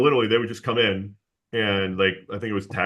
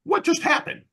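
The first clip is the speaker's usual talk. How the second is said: much higher, baffled squeak of shock; stunned disbelief. Retold or imitated. Retelling his confusion after the caller hung up, stunned high-pitched disbelief. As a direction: act totally thrown by the call. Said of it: "And I'm like".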